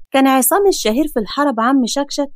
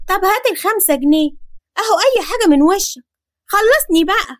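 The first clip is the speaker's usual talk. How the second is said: put-on higher, pushy child begging; just give me something; impatient kid; 6 semitones higher than usual. Boy nagging neighbour for money, high and pushy.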